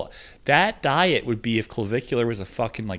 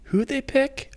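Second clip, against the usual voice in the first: surprised; slower than usual. Real, not acted. Excited reaction to draft pick.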